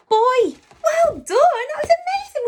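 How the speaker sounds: high, loud delighted cheer; excited praise. Real, not acted. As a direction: burst out with joy at the class.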